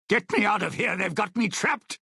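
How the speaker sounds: high-pitched, urgent cry for help; trapped and pleading to get out; urgent plea. Acted. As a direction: call out to be rescued from a trap. Trapped, calling out for rescue.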